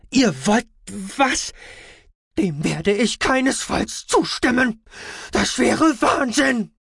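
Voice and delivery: high, slow outraged protest. A narrator's reading. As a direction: rise to an indignant shout. Aspartamin outraged at the plan. (empörter Aufschrei)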